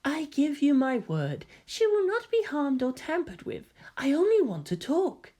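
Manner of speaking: high, soft and poised; a lightly mocking lady voice; genteel high voice, calm and sly; dainty feminine voice. A narrator's reading. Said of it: "Karu sighed".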